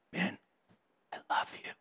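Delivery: whispered, soft and close; still small voice. Retold or imitated. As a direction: breathe it like a gentle secret voice. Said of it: "a still small voice".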